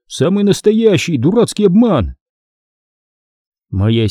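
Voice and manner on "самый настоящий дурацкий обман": high, indignant outburst; angry shout. A narrator's reading. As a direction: protest crossly, pitched up. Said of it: "сердито закричал я". (сердито, возмущённо)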